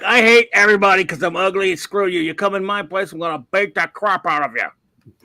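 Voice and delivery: loud, sneering monster growl; grumpy brute voice. Retold or imitated. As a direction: spit it out like a hateful brute. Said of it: "He's like".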